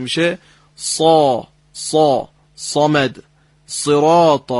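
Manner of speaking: صا and صراط with a full, heavy ص, slow; heavy ص demo. Shown as a demonstration. Teacher demonstrates the heavy ص sound to the caller. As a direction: drag out a thick, throaty ص.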